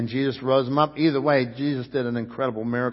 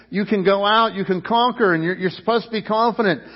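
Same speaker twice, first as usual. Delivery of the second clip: loud, high-pitched preaching; full-force preaching; 6 semitones higher, 5 dB louder than usual. Acted. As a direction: preach it hard to the crowd.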